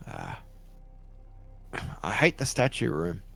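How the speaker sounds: ordinary talk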